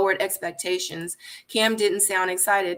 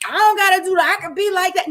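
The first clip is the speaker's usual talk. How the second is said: much higher, louder mock defiance; mocking defiant quote; 10 semitones higher, 5 dB louder, faster than usual. Mimicking people who won't change, high and mocking. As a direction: sass it like someone who refuses to change. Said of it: "out of this narrative thinking, like".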